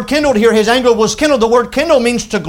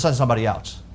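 much lower and softer, a meek retold request; reluctant plea; 10 semitones lower, 8 dB quieter, faster than usual. Moses asking God to pick another, low and reluctant. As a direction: imitate someone quietly begging off. Moses begging off from God's task.

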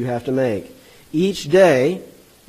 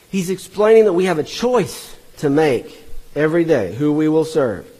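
usual speaking voice

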